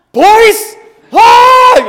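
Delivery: put-on very high, very loud roar at the kids; Hulk-mode yell. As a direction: explode at them in mock rage. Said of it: "I just went into Hulk mode".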